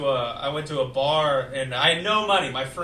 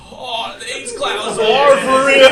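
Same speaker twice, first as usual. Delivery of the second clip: awed laugh; 7 semitones higher, 13 dB louder, slower than usual. Retold or imitated. Tripping in park, amazed.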